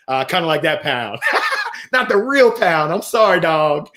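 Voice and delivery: joking, breaking into laughter; amused aside with a laugh. Real, not acted. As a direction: chuckle through your own joke. Laughing at own pound joke.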